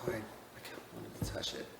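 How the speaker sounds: soft voiceless whisper. Real, not acted. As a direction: breathe it like a guilty secret. Whispered confession.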